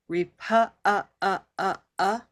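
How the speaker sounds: "repu-" halted, then short repeated "uh"s; caught vowel. Shown as a demonstration. Teacher isolating the vowel for everyone, "repu-" then echoed "uh". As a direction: break off "repu-" and pulse the "uh" on its own. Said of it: "we'll catch the vowel".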